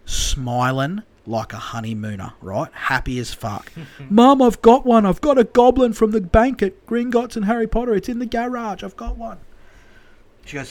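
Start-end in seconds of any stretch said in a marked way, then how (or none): 4.1-9.4 high, loud, triumphant imitation; overjoyed high-pitched boast; excited son. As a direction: shout the news high, then let it tail off. Happy Joe announces his catch.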